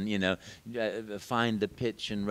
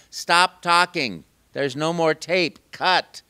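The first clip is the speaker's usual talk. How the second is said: director calling cut; 6 semitones higher, 8 dB louder, slower than usual. Retold or imitated. Retold director calling stop.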